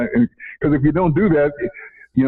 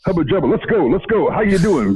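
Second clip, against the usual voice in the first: upbeat greeting; 5 semitones higher than usual. Retold or imitated. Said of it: "it's a positive greeting".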